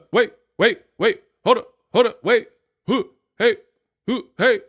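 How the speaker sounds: high loud laughing calls; shouting wait through laughter; loud friendly calls. Real, not acted. Calling out, laughing.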